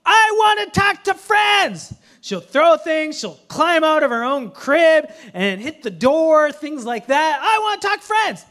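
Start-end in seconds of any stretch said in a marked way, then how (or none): 0.0-1.9 put-on high slow toddler shout; fierce toddler yell. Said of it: "get fierce". 7.4-8.4 put-on high toddler shout; toddler yell. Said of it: "She'll scream louder".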